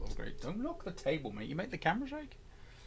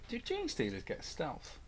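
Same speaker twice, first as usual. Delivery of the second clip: usual speaking voice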